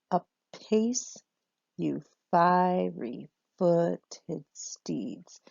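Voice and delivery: slow, each beat stressed in turn; iambic scansion. Shown as a demonstration. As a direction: read it out in strict iambic meter. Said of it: "So this is all nice and regular".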